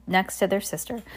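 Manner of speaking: plain reading voice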